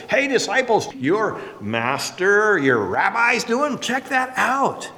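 lightly voiced scornful accusers, words drawn out; a touch of mock reproach, stretched slowly; grumbling Pharisees. Preacher imitating Pharisees needling the disciples, grumbling. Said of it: "they grumbled against his disciples".

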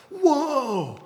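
high, falling whoa of wonder; amazed whoa. Acted. Synagogue crowd hearing Jesus teach, amazed. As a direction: gasp out a high, awed whoa.

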